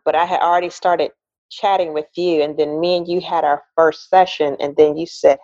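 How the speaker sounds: normal talking voice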